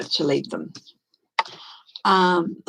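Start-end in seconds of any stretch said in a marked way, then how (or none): none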